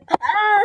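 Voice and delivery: sudden high-pitched yelp; mock scream. Stopping short of saying the rude word, mock scream. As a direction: cut yourself off with a high "ah".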